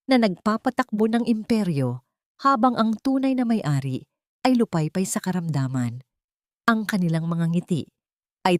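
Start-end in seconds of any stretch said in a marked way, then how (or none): none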